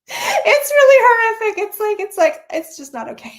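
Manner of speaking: high, loud laugh breaking through the words; real laughter, barely getting it out. Real, not acted. Laughing about brother's impression.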